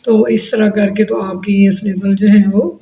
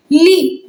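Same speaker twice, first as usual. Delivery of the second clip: "ली" held as a separate syllable; "ली" drawn out for counting; 5 semitones higher than usual. Shown as a demonstration. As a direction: sound out "ली" on its own, slowly. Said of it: "दो बन गया".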